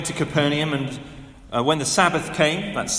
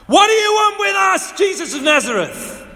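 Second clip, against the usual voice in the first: shrieking, much higher and louder demon cry; possessed man crying out; 9 dB louder than usual. Acted. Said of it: "cried out".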